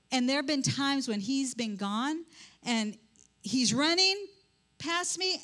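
ordinary talk, nothing marked

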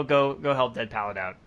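normal talking voice

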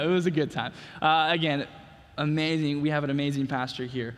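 ordinary talk, nothing marked

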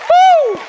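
high, loud whoop of praise; joyful woo shout. Real, not acted. Pastor whooping in praise to the church. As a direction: let out a big woo.

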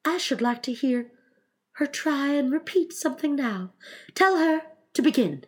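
high, unhurried figure voice; musing Mock Turtle. Mock Turtle wants Alice to recite, high voice. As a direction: pitch it high, rising toward the order.

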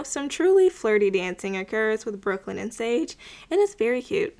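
ordinary talk

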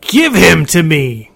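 high, loud howling demand; bellowing monster. A narrator's reading. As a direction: bellow like a wounded beast. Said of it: "bellowed and howled".

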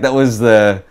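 ordinary talk

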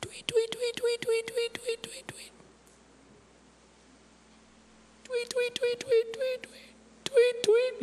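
very high, soft bird chirping; mock birdsong to the congregation; tweet, tweet. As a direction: chirp like a carefree little bird. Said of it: "You see 'em?".

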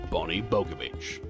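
ordinary talk, nothing marked

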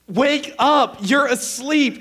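high, drawn-out, firm order; exasperated wife. Retold or imitated. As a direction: imitate a wife shouting her husband awake. Acted quarrel, wife's side.

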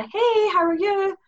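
high, slow sing-song "Hey, how are you?"; bright cheery greeting. Shown as a demonstration. Guest showing the friendly tone texts lose, high and slow. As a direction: chirp it high and sweet. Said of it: "tone you can't get off".

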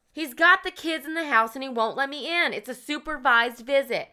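urgent, rattled retelling of the caller; distraught plea. Retold or imitated. Social worker pleading with the dispatcher, imitated distress. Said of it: "she's very, like, distraught".

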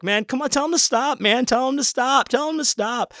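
very high, whiny mock plea; panicked pleading. Tripping kid begging a friend for help, mock panicked pleading. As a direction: plead in a squeaky frightened falsetto.

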